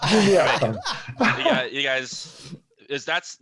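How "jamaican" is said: loud Jamaican-accented "Jamaican". Shown as a demonstration. Player naming his character's Jamaican accent, loud Jamaican accent. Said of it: "what the accent I'm actually doing".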